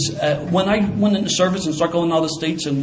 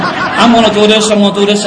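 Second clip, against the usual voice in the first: insistent boast; 4 semitones higher, 9 dB louder than usual. Retold or imitated. Telling his buddies at the ballgame, loudly insistent. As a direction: hammer it home like a dare. Boasting he'll run onto field.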